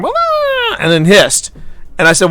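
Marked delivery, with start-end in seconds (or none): 0.0-0.8 very high, squeaky yelp imitating a hurt cat; sudden high-pitched cat cry; cat's pained yowl. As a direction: play the cat crying out as she's moved. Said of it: "and he goes like".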